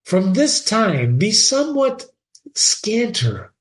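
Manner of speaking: put-on high, slow warning old father; stern father. Polonius forbidding Ophelia, stern high father. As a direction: lay down the law.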